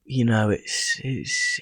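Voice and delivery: usual speaking voice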